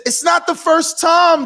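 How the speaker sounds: shouted and high, slow; emphatic shout. Real, not acted. Guest insisting to the host, a high slow shout. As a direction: hit it hard, loud and slow.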